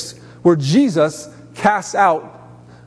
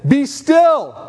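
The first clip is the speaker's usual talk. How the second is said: Jesus's command, raised and higher; 7 semitones higher than usual. Acted. Preacher voicing Jesus calming the storm, commanding. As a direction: call it out with authority.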